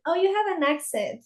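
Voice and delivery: hint of a bright American noting her accent; mock American remark. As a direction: chirp it like a curious local. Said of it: "cuando me dicen".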